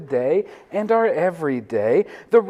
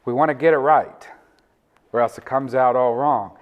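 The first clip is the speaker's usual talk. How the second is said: usual speaking voice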